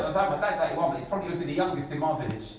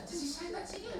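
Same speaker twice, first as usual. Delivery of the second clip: high, soft, careful question; hushed concern; 12 semitones higher, 14 dB quieter, faster than usual. Retold or imitated. Parent checks what Granddad says to child, hushed concern. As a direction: ask softly, pitched right up.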